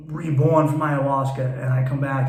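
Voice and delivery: ordinary delivery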